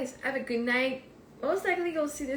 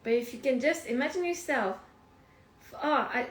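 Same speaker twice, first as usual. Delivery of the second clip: ordinary delivery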